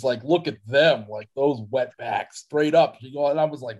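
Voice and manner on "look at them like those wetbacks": normal talking voice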